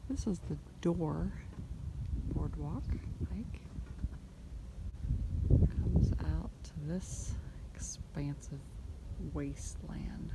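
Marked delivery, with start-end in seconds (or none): 2.3-10.3 whispering. Real, not acted. Whispering on hike.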